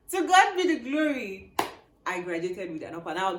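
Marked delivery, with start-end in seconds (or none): 0.1-1.5 high, loud, laughing exclamation; jubilant thanksgiving. Real, not acted. Vlogger celebrates graduating, laughing jubilantly. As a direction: proclaim it through real laughter.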